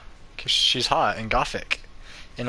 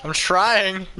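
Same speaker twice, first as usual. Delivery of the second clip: ordinary delivery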